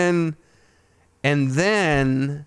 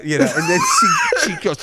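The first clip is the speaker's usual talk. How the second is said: bursting into a high laugh. Real, not acted. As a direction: talk through a shriek of laughter. Laughing while telling.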